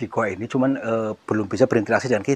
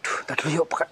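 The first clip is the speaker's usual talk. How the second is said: hushed and higher whisper; scared whisper; 3 semitones higher, 6 dB quieter, faster than usual. Real, not acted. Ghost hunter whispers to crew to wait, nervous whisper. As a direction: whisper it, nerves shot.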